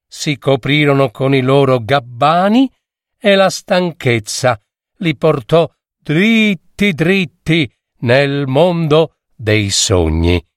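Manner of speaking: plain reading voice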